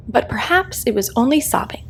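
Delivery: ordinary delivery, nothing marked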